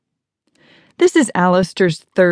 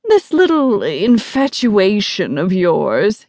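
slow, lofty belittling; haughty disdain; 3 semitones higher, slower than usual. Acted. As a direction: belittle the boy, drawing it out. Arrogant guest belittles boy's romance.

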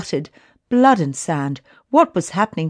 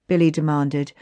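ordinary delivery, nothing marked

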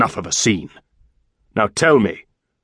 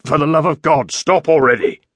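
exasperated cry; 3 semitones higher than usual. A narrator's reading. Same man still begging her stop.

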